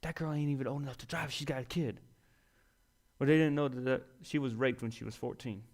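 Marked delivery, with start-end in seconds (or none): 0.0-1.3 hushed gossip whisper. Retold or imitated. Pew gossips whispering about the young mother, hushed whisper. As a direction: whisper it behind her back. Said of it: "I hear all the whispers".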